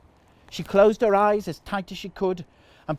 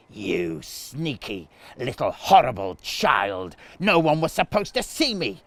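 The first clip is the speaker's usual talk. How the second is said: demon's snarl; 3 semitones lower than usual. A narrator's reading. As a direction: growl it like a slimy demon. Demon Clive snarls at boy.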